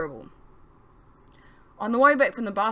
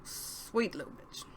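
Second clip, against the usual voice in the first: muttered curse; 2 semitones higher, 10 dB quieter, slower than usual. Acted.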